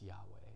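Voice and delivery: Yahweh breathed out as an unvoiced whisper; breathed name. Shown as a demonstration. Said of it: "It's the same sound as when we would breathe".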